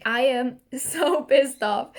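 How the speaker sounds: "so" stretched, laugh breaking through a slow complaint; laughing while angry. Real, not acted. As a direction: say you're furious but laugh. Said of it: "I'm smiling, I'm laughing".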